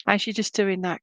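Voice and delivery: ordinary delivery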